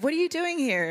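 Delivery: put-on a light, high-pitched German accent; mock German accent. Berlin landlord asking what she's doing, in mock German accent. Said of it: "in his German accent".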